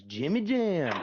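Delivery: high, drawn-out mock holler; retold calling out. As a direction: call it out like greeting across a room. Retold new dude calling out nickname.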